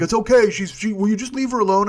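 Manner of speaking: high, loud pleading woman; defensive friend. Retold or imitated. Friend snaps at driver.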